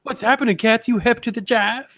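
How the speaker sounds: high hepcat jive voice; 1940s jive talker. Retold or imitated. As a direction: play a swinging Harlem hipster. Imitating jive-talking grandmother.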